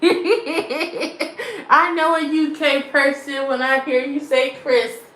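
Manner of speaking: amused teasing. Real, not acted. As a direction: tease through laughter, voice lifted. Laughing while teasing a UK viewer.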